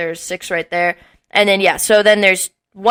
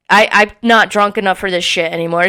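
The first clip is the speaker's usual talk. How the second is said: ordinary talk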